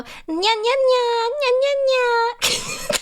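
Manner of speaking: high, rising repeated nyas; high, rising imitation nyas; imitating nya compilation. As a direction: parrot the anime girls' nyas. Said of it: "Oh, they go".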